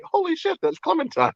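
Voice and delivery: high, startled recognition; high mock gasp of recognition; retold surprise. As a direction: squeak it out like you just spotted a star.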